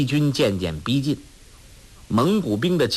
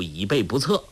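ordinary delivery, nothing marked

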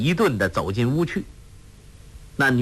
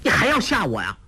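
resentful; 8 semitones higher, faster than usual. A narrator's reading. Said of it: "恨恨地说".

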